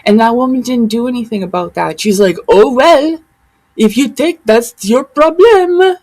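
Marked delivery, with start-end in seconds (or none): 2.5-6.0 high, loud mock "not my problem" voice; mocking dismissiveness. Imitating a woman who shrugged off the warnings, mockingly dismissive. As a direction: throw it off in a raised, put-on pitch. Mocks another woman's uncaring reply.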